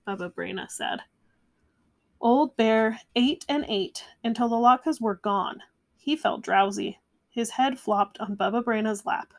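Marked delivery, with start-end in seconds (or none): none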